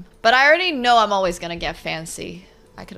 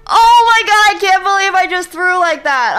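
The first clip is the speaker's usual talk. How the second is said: much higher, loud anguished yelling; furious shout; 12 semitones higher, 12 dB louder, slower than usual. Real, not acted. Streamer yelling at herself after throwing the game, loud and anguished. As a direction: scream at your own blunder.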